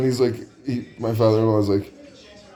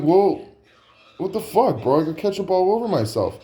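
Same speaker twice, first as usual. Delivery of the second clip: high, mock-indignant pleading; exasperated protest; 9 semitones higher than usual. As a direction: push the complaint up high, playing aggrieved. Pleading again with indifferent staff.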